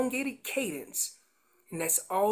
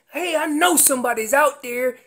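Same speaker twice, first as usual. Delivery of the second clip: a mock bull's call, louder and higher; excited bull's yell, louder and higher; 4 semitones higher, 8 dB louder than usual. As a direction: call out like a rutting bull looking for a fight. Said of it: "Remember, I'm yelling".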